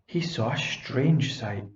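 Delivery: ordinary delivery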